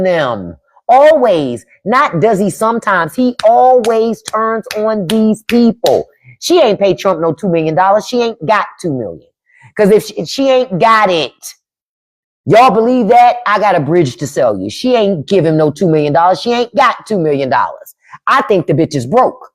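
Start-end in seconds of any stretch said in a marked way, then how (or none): none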